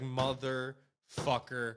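low, soft, drawn-out curse; weary mock annoyance. Cop watching the driver leave his house, slow weary annoyance. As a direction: curse slowly under your breath like a fed-up cop.

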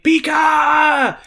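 high, loud shouted Pika cry; Pikachu cry. Retold or imitated. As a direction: belt it out like Pikachu finding his voice. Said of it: "this Pikachu finally finds his voice".

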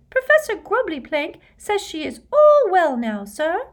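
loud, very high elf squeak; high-pitched, devoted house-elf; squeaky elf. A narrator's reading. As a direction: report it eagerly in a squeak. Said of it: "said the elf squeakily".

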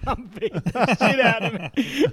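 high, loud, slow retold yell; mock yell. Retelling a classroom fight to his mates, laughing mock yell. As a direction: draw it out loudly, half laughing. Acted quote in fight story.

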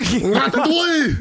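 slow, hammed-up French; drawn-out mock French; French accent. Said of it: "don't know anything about France".

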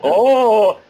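loud, high drawn-out oh; taken-aback reaction. Retold or imitated. Actor hears the odd direction to read, taken-aback reaction. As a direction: let out a big acted oh. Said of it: "that was my reaction".

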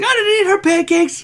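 very high, loud comic protest; mock indignant denial. Accused man denying he ate pancakes, squeaky mock indignation. Acts out squabble over pancakes.

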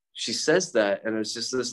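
ordinary talk, nothing marked

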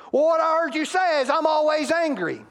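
a hammed-up defensive mate, high and loud; defensive spouse. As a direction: play the hurt spouse twisting what was said. Defensive spouse mishears partner.

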